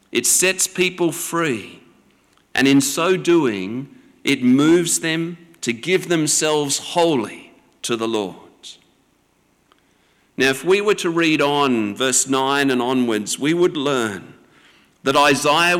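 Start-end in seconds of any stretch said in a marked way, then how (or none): none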